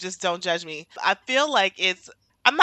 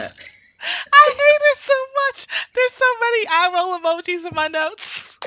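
exasperated rant; 12 semitones higher, 7 dB louder than usual. Real, not acted. Venting about overused slang.

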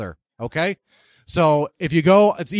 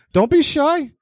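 calling out; 8 semitones higher, slower than usual. Real, not acted. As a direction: call it out to the whole room, coaxing. Host calls again to the room.

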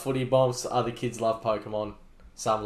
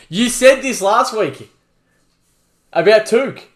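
loud accusation; 9 semitones higher, 10 dB louder, slower than usual. Real, not acted. Accusing co-host.

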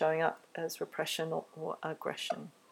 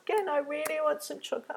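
put-on higher, pleading child's voice; whiny child; 10 semitones higher, faster than usual. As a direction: beg like a little kid wanting chocolate. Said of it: "And they might ask".

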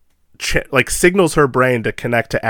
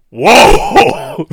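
loud, high, drawn-out gasp of shock; re-enacted horrified cry; shocked whoa; 12 semitones higher, 7 dB louder than usual. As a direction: howl a stunned whoa. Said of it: "like, you were like".

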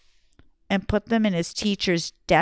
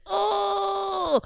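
high, sudden disgusted gasp; disgusted gag; 9 semitones higher than usual. Retold or imitated. Kids hear how the rotten egg smells, high disgusted gag.

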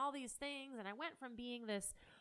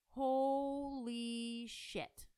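slow, drawn-out holy shit in a changed voice; stunned, dragging it out; shocked; 5 semitones higher, much slower than usual. Real, not acted. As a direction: let the shock hang on every word. Shock at daughter's messy room.

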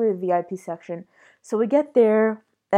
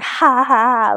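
higher, louder mock ha-ha; imitated laughter; 4 semitones higher, 5 dB louder, slower than usual. Teller imitating friends at the club, mock laughter. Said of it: "Everyone was just laughing".

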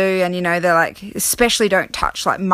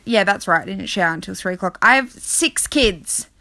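ordinary talk

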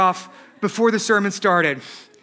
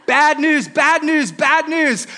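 higher, punchy alarmed exclamations; mock outrage; 5 semitones higher than usual. Mimics outraged public reacting to headline.

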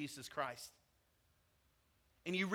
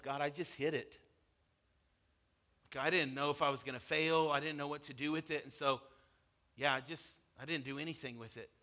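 sad confession; 4 semitones lower, 3 dB quieter than usual. Retold or imitated. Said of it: "a sad moment".

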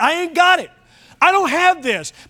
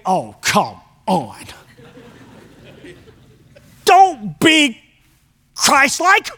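sarcastic inner voice; much slower than usual. Acted. Inner voice mocking advice to be meek.

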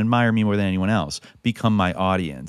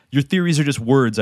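higher, louder, indignant comeback; sharp retort; 7 semitones higher, 6 dB louder than usual. A narrator's reading. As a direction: throw the words back at her. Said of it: "I retorted".

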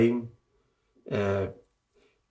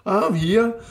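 much higher, joyful "Yes, I'm here"; imitating joyful cry; 12 semitones higher, much slower than usual. Imitating Tommy Burns celebrating a goal, overjoyed. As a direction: play an overjoyed manager celebrating. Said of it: "He's like".

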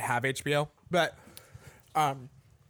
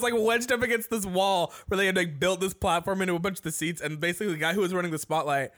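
ordinary delivery

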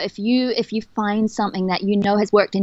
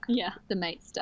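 whisper; 3 semitones lower, 11 dB quieter than usual. Real, not acted. Quiet aside agreeing with sister.

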